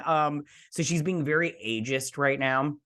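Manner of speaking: ordinary talk, nothing marked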